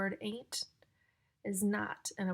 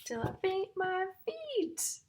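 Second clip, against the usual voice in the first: much higher, slow, sing-song; 10 semitones higher, 3 dB louder, slower than usual. Acted. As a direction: sing out the rhyme. Rhyme line sung out high.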